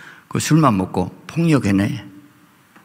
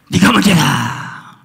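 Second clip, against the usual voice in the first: 하나님 음성; 5 semitones higher, 5 dB louder, much slower than usual. Acted.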